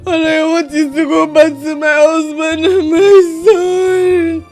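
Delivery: tearful begging, voice rising; crying plea. Acted. Crying as she begs her sister to plead with her husband. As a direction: cry and beg for help getting home.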